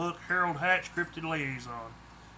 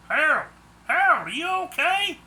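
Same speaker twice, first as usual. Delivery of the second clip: higher, louder calling out; Clovis calling; 11 semitones higher, 6 dB louder than usual. A narrator's reading. A neighbour searches for Harold, calling loud and high. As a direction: call his name from afar, worried. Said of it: "I heard Clovis calling".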